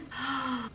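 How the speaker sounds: a quick quiet intake of delight; soft excited gasp. Real, not acted. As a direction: gasp softly as an idea lands. Thinking of things to cook.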